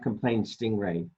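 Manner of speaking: plain reading voice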